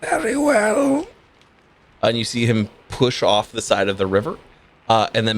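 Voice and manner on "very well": slow, drawn-out rasp; very raspy ferryman. Acted. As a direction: rasp it out slowly like an eerie ferryman. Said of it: "in a very raspy voice".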